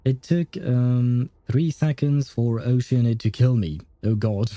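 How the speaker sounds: usual speaking voice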